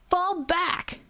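urgent shout of fall back; shouted order. A narrator's reading. Borovo ordering the team to retreat, shouting. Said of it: "Borovo shouted".

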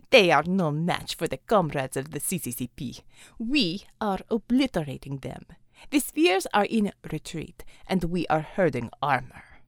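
a Russian commander speaking English; Russian accent. Acted. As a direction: lay on a thick Russian accent. Russian figure Xavier.

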